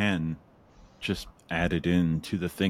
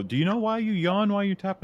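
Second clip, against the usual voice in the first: high curious question; 13 semitones higher, 3 dB louder, faster than usual. Pretend. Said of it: "somebody will go".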